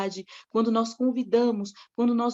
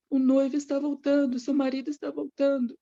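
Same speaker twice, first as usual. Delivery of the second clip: higher-pitched, urgent proclamation; heralding cry; 4 semitones higher than usual. Acted. Bridegroom's friend waking the sleeping maidens, heralding cry. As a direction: call it out like a herald, short of a full shout. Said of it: "Quem saiu gritando foi o melhor amigo do noivo".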